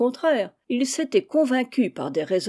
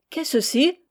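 higher-pitched exclamation; exclaimed question; 7 semitones higher than usual. A narrator's reading. Someone hands uncle the folded paper, exclaiming. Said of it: "quelqu'un de s'exclamait".

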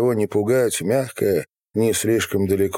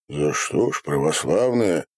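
usual speaking voice

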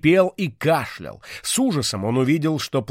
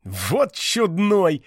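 slow, higher, amused drawl; amused smirk; 6 semitones higher, much slower than usual. Acted. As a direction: chuckle at the silly dragon. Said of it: "усмехнулся Вико".

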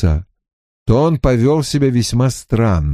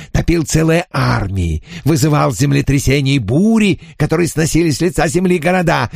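boastful spirit; 3 semitones higher than usual. Acted. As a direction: relish every killing boast.

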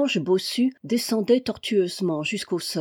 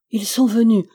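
louder sudden cry of realisation; exclaiming; 2 semitones higher, 6 dB louder than usual. Acted. Alix realises the killers came, a louder exclamation. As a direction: blurt it out as it dawns on her. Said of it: "s'écria-t-elle".

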